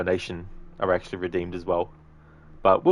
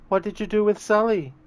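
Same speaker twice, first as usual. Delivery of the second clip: high-pitched second character; movie sidekick voice; 14 semitones higher, 4 dB louder than usual. Acted. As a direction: voice the action hero's sidekick, pitched way up. Sidekick's part in acted movie scene.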